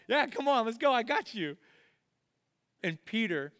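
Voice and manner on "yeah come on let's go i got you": a bit higher, upbeat coaxing; encouraging parent. Acted. Dad in the pool encouraging his child, cheerful. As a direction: cheer the kid into jumping. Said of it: "and you're like".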